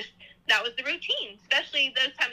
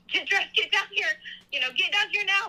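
retold hollering; 8 semitones higher than usual. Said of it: "my dad was just hollering up at his girls".

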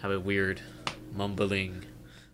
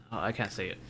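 ordinary talk

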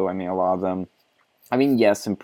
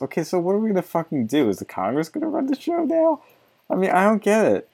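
exasperated disbelief; 10 semitones higher than usual. Real, not acted. Exasperated at the article.

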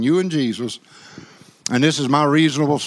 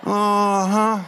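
lightly voiced sassy sister shooing the devil; a hint of strutting church lady, ending in a laugh; 4 semitones higher, much slower than usual.

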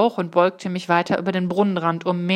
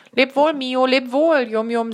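higher-pitched farewell call; calling goodbye; 6 semitones higher, 3 dB louder than usual. A narrator's reading. Jiri seeing Mio and Yum Yum off, calling out. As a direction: sing out a goodbye to departing friends.

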